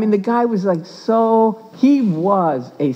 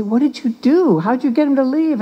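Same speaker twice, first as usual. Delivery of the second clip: higher-pitched retold disbelief; amazed questioning; 7 semitones higher than usual. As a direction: imitate baffled colleagues, pitched up. Acted amazed questioning by colleagues.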